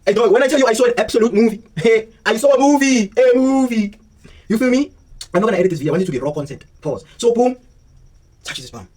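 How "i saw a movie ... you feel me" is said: higher, laughing as he tells it; can't believe what he saw, laughing; giddy disbelief with a laugh; excited laughing. Real, not acted.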